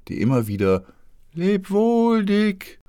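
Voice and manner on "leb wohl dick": much higher, louder farewell; calling out. A narrator's reading. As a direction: call to the boy on the shore. (laut rufender Abschied)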